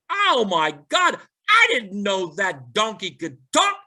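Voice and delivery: high-pitched, hammed-up amazement; astonished old man. Old man to his dog about the talking donkey, high mock astonishment. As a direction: exclaim it high, stunned by what just happened.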